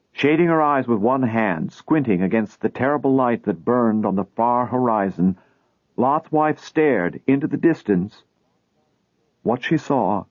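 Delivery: ordinary delivery, nothing marked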